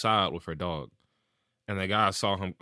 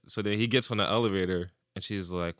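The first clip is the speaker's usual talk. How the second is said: usual speaking voice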